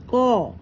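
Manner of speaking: കോ with the o drawn out; long vowel. Shown as a demonstration. Teacher explains the long sign to pupils, drawn-out o in കോ. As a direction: hold a long o in കോ. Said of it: "ഓ ശബ്ദം വരുമ്പോ ദീർഘം".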